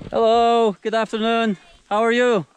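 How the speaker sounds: high, loud friendly call to passers-by; calling out a greeting. Real, not acted. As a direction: shout a cheerful hello across to them. Greeting villagers passing by.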